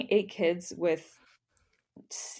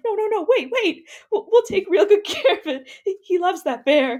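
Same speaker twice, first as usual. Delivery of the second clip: put-on high, pleading kid voices; high frantic children pleading; 10 semitones higher, 4 dB louder than usual. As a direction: beg like kids saving a toy. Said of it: "They're like".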